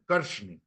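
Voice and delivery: "carne" with a hushing r; dialect pronunciation. Shown as a demonstration. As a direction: say "carne" as "carshne". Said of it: "¿El dialecto fonético qué es? Pronunciación".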